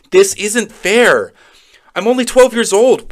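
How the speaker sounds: put-on high, loud kid's complaint; indignant protest. Child caught in the middle protests, high loud indignation.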